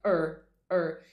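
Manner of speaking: er, er held with a curled R; repeated R-colored er; er sound. Shown as a demonstration. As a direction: hum out the er sound. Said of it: "o som do er".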